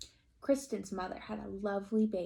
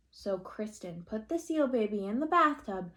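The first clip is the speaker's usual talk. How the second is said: plain reading voice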